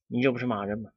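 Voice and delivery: usual speaking voice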